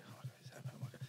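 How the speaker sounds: breathless whispered excitement, retold; hushed panic whisper. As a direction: whisper it to yourself, smitten. Retold secret excitement seeing crush.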